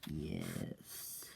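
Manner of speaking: very deep, hushed menace; low villain voice. Retold or imitated. Scar guy admits it to Bloodman, deep hushed villain voice. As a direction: drop right down low and quiet, drawing it out.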